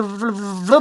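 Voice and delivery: normal talking voice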